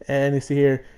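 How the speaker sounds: usual speaking voice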